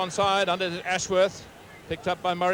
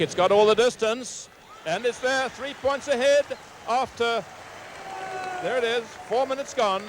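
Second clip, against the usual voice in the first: thrilled call of a successful goal kick; excited call; 8 semitones higher than usual. Real, not acted. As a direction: lift the voice with the ball. Penalty kick goes over.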